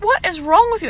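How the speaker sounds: what on earth is wrong with you; exasperated. Retold or imitated. Owner to howling puppy in crate, high exasperated.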